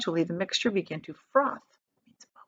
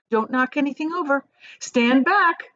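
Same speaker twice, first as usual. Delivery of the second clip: higher, louder warning to stand back; warning call; 6 semitones higher, 4 dB louder than usual. A narrator's reading. Wonka warning visitors away from machine, calling out. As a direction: call out a sharp caution to the group.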